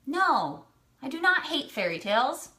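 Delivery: usual speaking voice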